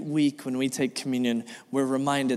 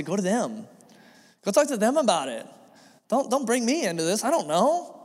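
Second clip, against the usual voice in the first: much higher, rising, pushing the gossip away; emphatic refusal; 9 semitones higher than usual. Retold or imitated. Pastor acting out refusing to hear gossip, high and insistent. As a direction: throw up your hands and refuse to get involved.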